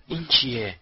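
understated startled cry; mild cry. A narrator's reading. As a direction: lightly raise the voice in a cry. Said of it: "رون فریاد زد". (فریاد ملایم)

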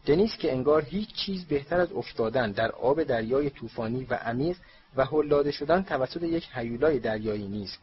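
ordinary delivery

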